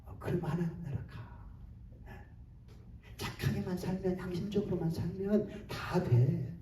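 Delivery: soft, low-key tempter's murmur; quiet, insinuating devil's aside; devil's whispering. Acted. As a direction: lightly coax in a hushed voice. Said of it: "속삭입니다".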